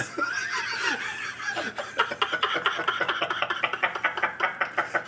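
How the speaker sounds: high breathless wheezing laugh. Real, not acted. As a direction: laugh until no sound comes out. Long wheezing laugh at joke.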